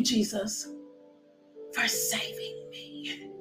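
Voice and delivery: ordinary delivery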